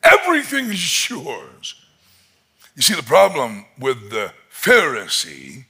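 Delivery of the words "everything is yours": pleading father. Acted. Father pleading with his older son, high and anguished. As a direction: cry it out as a father who can't believe it. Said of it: "I can only imagine that father saying".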